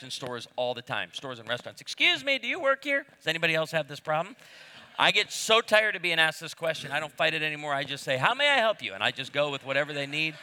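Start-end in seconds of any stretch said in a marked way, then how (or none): 1.8-3.1 much higher, polite customer voice; inquiring customer. Retold or imitated. Shopper mistaking him for store staff, high inquiring voice. 8.2-8.9 lightly voiced shop assistant, higher pitch; a hint of obliging customer-service lilt; helpful employee. Playing along as store staff, lightly voiced helpful employee.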